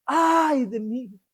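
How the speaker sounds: quiet, slow sigh of woe; a hushed, drawn-out lament; slow muted lament. Shown as a demonstration. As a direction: let it fall softly like a resigned sigh. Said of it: "No sé si así".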